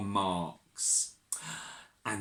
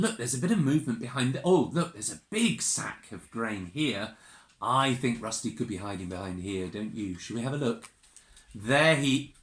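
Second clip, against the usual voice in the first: usual speaking voice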